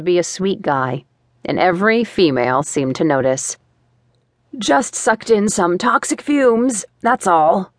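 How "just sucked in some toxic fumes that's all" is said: hoarse croak after coughing; croaky voice. Acted. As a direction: croak it like a swallowed toad. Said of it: "I sounded like I'd swallowed a toad".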